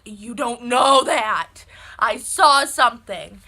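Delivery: loud, drawn-out retold shout; emphatic retort. Daughter contradicting mom in the hallway, loud emphatic shout. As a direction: insist loudly, leaning hard on each word.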